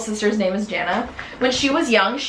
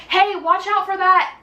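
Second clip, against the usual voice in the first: called-out warning; 8 semitones higher than usual. Retold or imitated. Calls a warning back to friends.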